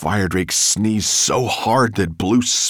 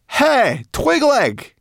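high, loud hail; calling out; 7 dB louder, much slower than usual. A narrator's reading. Sorrel hails the homunculus, high loud call. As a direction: shout to get someone's attention.

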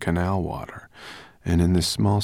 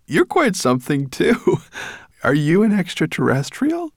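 high, louder, amazed; delighted; 10 semitones higher, 6 dB louder than usual. Acted. Boy marvelling at the brownie with delight. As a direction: marvel at her, grinning.

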